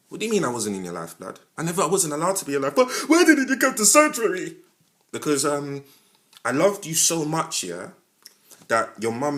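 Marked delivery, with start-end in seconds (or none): 2.7-4.6 high, loud mock child's whine; hammed-up kid pleading why; a film child blaming Dad; child asking. Child's accusation.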